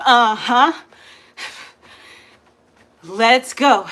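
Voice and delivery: loud, high, pumped-up instructor calls; hype coaching. Acted. Instructor pumping up her workout class, loud hype. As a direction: fire up the class like a spin coach.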